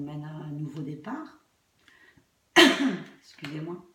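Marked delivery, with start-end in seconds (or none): 2.5-3.2 sore-throat cough; hacking cough. Real, not acted. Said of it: "j'ai une angine".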